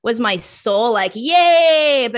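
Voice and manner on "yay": loud, high drawn-out cheer; mock cheer. Asking whether her soul cheered the new job, mock cheering. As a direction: play an exaggerated cheer, long and high.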